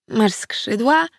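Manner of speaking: put-on a little girl's slow wondering question; curious girl. A narrator's reading. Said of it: "zapytała Salcia".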